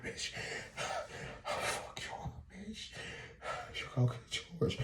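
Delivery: barely voiced, panting insults. Exhausted fighters finishing off, mock breathlessness. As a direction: sigh between words, too winded to threaten. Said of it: "even more out of breath".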